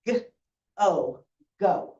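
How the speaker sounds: slow phonics sounding out of "go". Shown as a demonstration. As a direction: sound out letter by letter, then blend. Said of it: "we're gonna sound it out".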